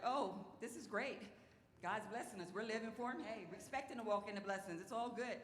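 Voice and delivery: playful re-enacted contentment; retold delight. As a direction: act out being happily blessed. Acts out couple's delight at blessings.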